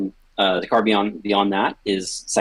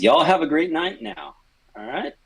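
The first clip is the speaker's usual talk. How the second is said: high, bright folksy goodbye; cheery farewell; 10 semitones higher than usual. Acted. Salesman cheerfully bidding everyone goodnight. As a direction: sing out a friendly goodnight.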